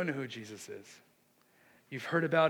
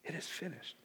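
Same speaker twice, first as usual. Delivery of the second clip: much softer, solemn quote; quiet, reverent repetition; hushed repeat; 10 dB quieter than usual. Retold or imitated. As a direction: let the last words drop to a hush. Last words repeated in a hush.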